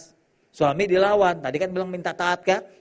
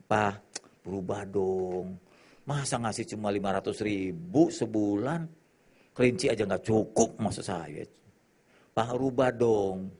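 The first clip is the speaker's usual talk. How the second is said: coaxing wife; 6 semitones lower, 4 dB quieter, slower than usual. Retold or imitated. Wife coaxing husband sweetly.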